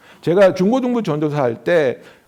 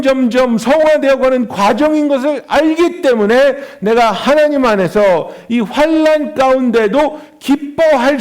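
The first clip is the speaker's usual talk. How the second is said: raised, emphatic sermon voice; preaching; 5 semitones higher, 4 dB louder, slower than usual. Real, not acted. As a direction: drive each phrase home to the congregation. Preaches on suffering and endurance. (힘주어 설교하는 목소리)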